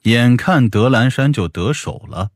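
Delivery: ordinary delivery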